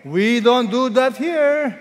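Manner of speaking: loud, high and drawn out; slow rallying cry to the congregation; emphatic set phrase. Shown as a demonstration. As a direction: raise the voice, stretch every word. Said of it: "what will you say?".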